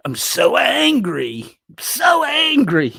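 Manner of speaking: high, slow sing-song I'm so angry; mock angry. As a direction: ham up fake anger in a squeaky high voice. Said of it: "I'm angrily laughing at you".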